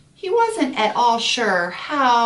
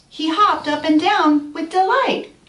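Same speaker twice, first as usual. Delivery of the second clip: high, loud delighted telling; excited; 10 semitones higher, 5 dB louder than usual. A narrator's reading. Storyteller sharing the pollywog's delight, excited. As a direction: bounce with the pollywog's joy. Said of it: "The pollywog was so excited".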